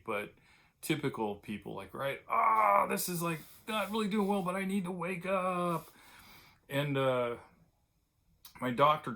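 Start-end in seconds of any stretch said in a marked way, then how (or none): 2.3-5.9 mock whiny excuse. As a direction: whine like every tired coffee addict. Typical coffee drinker's excuse.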